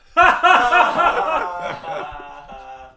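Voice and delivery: real loud laugh, breaking up; laughing hard at the absurd offer; loud burst of laughter. Real, not acted. Laughing out loud at being offered a pay rise.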